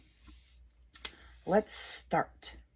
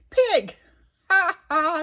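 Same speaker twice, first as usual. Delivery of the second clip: much higher, louder burst of delight; excited squeal; 8 semitones higher, 7 dB louder, slower than usual. Real, not acted. Spotting the pig card for her children's book, delighted squeal. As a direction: yelp the word with glee.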